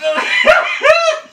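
high shrieking laugh; loud laughter. Real, not acted. Friend burns mouth on pepper.

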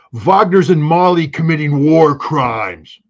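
loud, slow mock dumb American; dumb American accent. Mimicking critics on Russia in Africa, dumb American accent. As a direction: parrot it loudly in a dumb American drawl. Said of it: "my worst dumb American accent".

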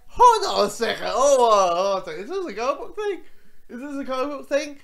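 loud, disbelieving "hold on, hold on a second"; incredulous double take. Retold or imitated. Realizing the character is from the comics, loud disbelief. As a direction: stop everything in mock alarm. Retold shock at character's name.

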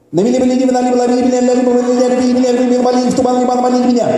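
high monotone speed patter; really fast. Shown as a demonstration. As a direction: race through it without a breath. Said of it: "say it really fast".

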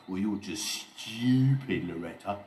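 sneering. A narrator's reading. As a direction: sneer it loudly, stretching the insult. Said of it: "sneered Oliver".

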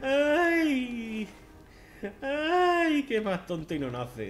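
high, loud despairing ay, slowing down; exasperated laugh. Real, not acted. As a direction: groan at own clumsiness. Exasperado por la torpeza. (lamento exasperado y risueño)

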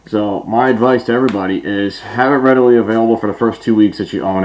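speaking inside full face mask; muffled through a gas mask. Real, not acted. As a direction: voice behind mask filter.

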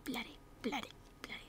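playful repeated whisper. Real, not acted. Whispering a swear over and over for fun. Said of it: "I'm just gonna keep saying it. It's fun".

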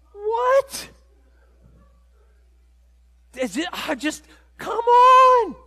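shocked, high, loud What and come on; stunned disbelief. Retold or imitated. Retelling the Costco cart encounter, shocked outburst. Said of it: "I was just like".

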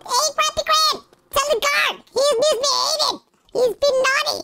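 high squeaky tattletale voice; playful tattling. Acted. Telling on someone misbehaving to the guard, squeaky tattling. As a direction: tattle way up high, giggly at points.

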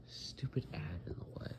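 annoyed under-breath whisper. Real, not acted. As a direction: grumble it in a whisper.

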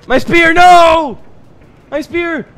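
high, loud, despairing no; anguished cry. Real, not acted. Gamer losing his spear cries out, high loud no. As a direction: shout as your spear slips away.

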